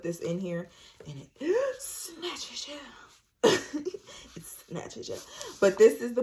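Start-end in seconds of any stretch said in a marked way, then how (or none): 1.1-3.9 gasping laugh. Real, not acted. Demoing the tight faja. 4.3-5.2 whispered repeat. Real, not acted. Whispered repeat to the viewers about the faja.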